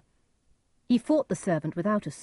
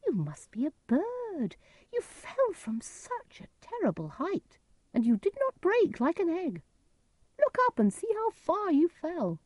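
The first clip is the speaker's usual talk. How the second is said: disguised stranger's voice; 12 semitones higher than usual. A narrator's reading. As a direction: put on a high voice, full of wonder. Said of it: "Edgar used a different voice".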